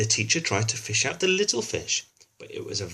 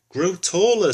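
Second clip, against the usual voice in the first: loud and high, a bossy queen; louder stage command; 8 semitones higher, 8 dB louder than usual. Acted. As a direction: boom it out like a queen giving orders. Ruth commands more urgently.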